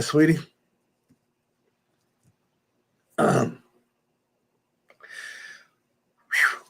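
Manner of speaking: struggling to get the words out through real emotion; choked up. Real, not acted. Recalling the call to his wife, choking up. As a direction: voice catching, breaking off into a sigh. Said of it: "Take your time".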